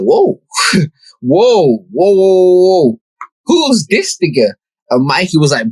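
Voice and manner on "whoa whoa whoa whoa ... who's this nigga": mock amazement. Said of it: "And I was like".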